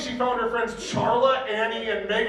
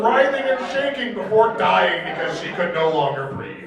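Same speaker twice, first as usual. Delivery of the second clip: ordinary talk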